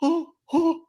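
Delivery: high, trembling mock fear; mock scared voice. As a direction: whimper like a scaredy-cat. Mocking being called scared.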